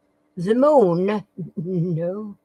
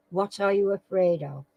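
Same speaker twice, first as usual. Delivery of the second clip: ordinary delivery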